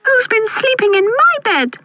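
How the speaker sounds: put-on very high baby bear squeak; tiny squeaky voice. A narrator's reading. Baby Bear finding his bed slept in, tiny squeak. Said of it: "squeaked Baby Bear in his tiny voice".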